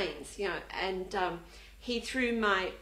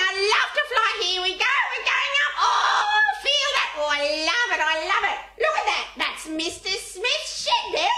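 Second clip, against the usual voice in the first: high, loud squawking bird voice; cockatoo; 9 semitones higher, 5 dB louder than usual. Retold or imitated. Woman playing a bird for a frightened girl, squawky cockatoo. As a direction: squawk with giddy delight, like a cockatoo in flight. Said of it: "me being a cockatoo and a magpie".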